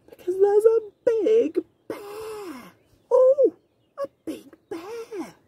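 playful storybook shock; surprised. Acted. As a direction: gasp out the big bear for the child. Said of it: "Surprised face".

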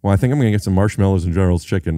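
normal talking voice

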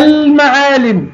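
المعالم called out high with a clear held l; pronounced lam. Shown as a demonstration. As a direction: say المعالم as alll-ma'aalim. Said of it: "نطقناها اللام".

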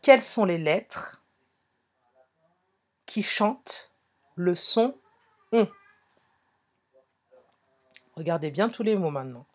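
ordinary talk

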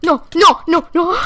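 loud, high burst of "no"; panicked shouting. Real, not acted. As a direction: cry out in sudden panic. Panic at near death in game.

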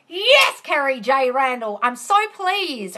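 high, loud burst of joy, then slow and pleased; delighted. Real, not acted. Host thanks a viewer for good news, excited and slow. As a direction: cheer the comment, then savour it. Thrilled by a success comment.

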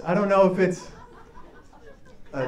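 ordinary talk

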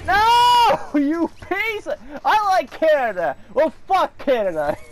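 loud, high cry of defeat; outraged yelling. Real, not acted. Gamer beaten in the game, outraged laughing yell. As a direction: shout it through laughter.

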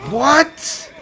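disbelieving shout. Real, not acted. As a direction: yell it out, fed up. Thrown off again.

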